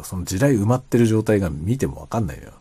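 ordinary talk

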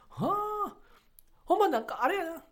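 high-pitched impression of a senior comedian. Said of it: "森山さんが". (先輩芸人の高い声真似)

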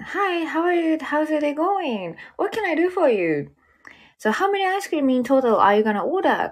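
server greeting in English. Retold or imitated. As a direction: greet the customer brightly and slowly. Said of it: "とかね". (店員らしく高めでゆっくりと英語で接客)

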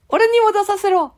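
put-on very high squeaky child voice; high boy puppet butting in; Ken-chan puppet voice. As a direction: pipe up high like a cheeky little boy. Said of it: "ケンちゃん。".

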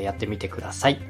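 ordinary talk, nothing marked

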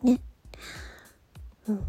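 usual speaking voice

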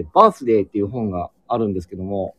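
ordinary talk

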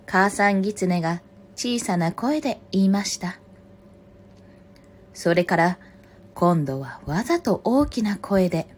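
projected storybook reading, phrase by phrase; story narration. A narrator's reading. Reader telling a fox and crow fable, story narration. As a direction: read aloud as to children, with pauses. Reads a picture book aloud.

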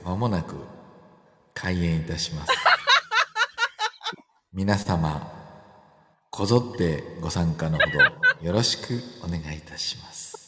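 polite venue announcement, soft; event announcer voice. Pretend. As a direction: announce the show like venue staff.

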